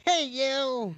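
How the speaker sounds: high, drawn-out singsong hello; playful greeting. Acted. Bather peeking from behind the curtain, high playful greeting. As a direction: greet them in a high, put-on lilt. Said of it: "I'm like".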